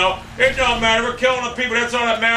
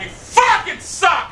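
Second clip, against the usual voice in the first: furious shout; 11 semitones higher, much slower than usual. Real, not acted. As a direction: drag every word out in rage. Raging after being killed.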